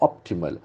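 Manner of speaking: optimal with the beat on "op"; stress on first syllable. Shown as a demonstration. Teacher modelling the word for class, stress on "op". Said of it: "The accent falls from the first syllable".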